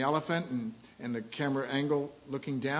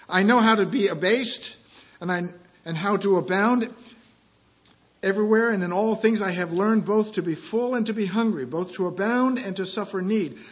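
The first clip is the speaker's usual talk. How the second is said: loud, raised proclaiming of scripture; scripture reading; 5 semitones higher, 7 dB louder than usual. Acted. Pastor reading Philippians to church, loud proclaiming. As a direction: declare the verse to the room. Reads scripture aloud in sermon.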